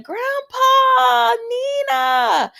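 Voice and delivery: high, drawn-out happy shout; excited calling out. Retold or imitated. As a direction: call across the room to grandpa, stretched high. Child excitedly calls out to grandpa.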